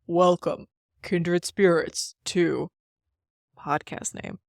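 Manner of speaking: slow, dramatic spooky host voice; haunting voice. Acted. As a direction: welcome them like a gothic host. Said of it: "Host in a deep, haunting voice".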